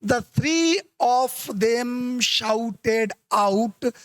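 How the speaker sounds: teacher walking through the phrase very slowly; very slow reading. Shown as a demonstration. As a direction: stretch each word very slowly. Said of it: "तो यहाँ पे देखो".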